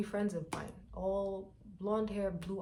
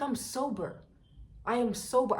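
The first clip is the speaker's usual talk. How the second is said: insistent protest; 6 semitones higher, 5 dB louder than usual. Retold or imitated. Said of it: "I was like".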